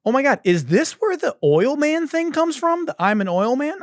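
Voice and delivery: put-on high, excited girlfriend realizing; imitating his girlfriend's excited realization. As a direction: squeal it like a sudden discovery. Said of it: "she's like".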